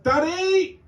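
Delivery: put-on high-pitched child calling Daddy; imitating a child, high and insistent; young son. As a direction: pipe up like a kid calling from another room. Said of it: "This was followed up with".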